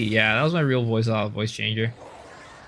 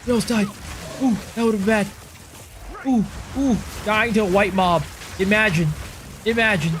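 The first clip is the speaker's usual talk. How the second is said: excited; 9 semitones higher, faster than usual. Real, not acted. After near death, loud high.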